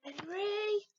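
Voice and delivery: high, loud call of the name; yell. A narrator's reading. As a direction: shout the name like a cross mum. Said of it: "yelled Mum".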